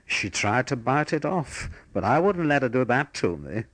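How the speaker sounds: imitating a Yorkshire soldier, lightly; Yorkshire accent. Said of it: "real Yorkshire accent said to me".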